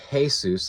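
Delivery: "Jesus" as hay-SOOS; "Jesus" starting hay, ending soos; Spanish pronunciation. Shown as a demonstration. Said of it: "like the Spanish-speaking Jesus".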